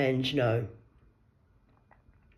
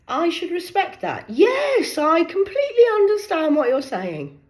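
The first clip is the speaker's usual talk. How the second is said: higher, sugary mock agreement; sarcastic sweet agreement; 11 semitones higher, 4 dB louder, slower than usual. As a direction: humour him with sweet sarcasm.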